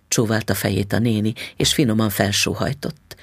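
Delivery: usual speaking voice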